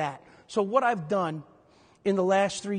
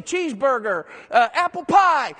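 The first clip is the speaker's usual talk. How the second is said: quarterback calls; 5 semitones higher, 6 dB louder than usual. Retold or imitated. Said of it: "He'll say".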